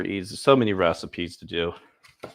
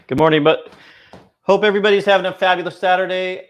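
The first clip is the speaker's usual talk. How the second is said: excited greeting; 10 semitones higher, 10 dB louder, faster than usual. Real, not acted. Greeting viewers.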